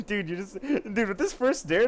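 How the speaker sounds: cracking up at the first dare; laughing. Real, not acted. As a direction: giggle through the words. Laughing at the story.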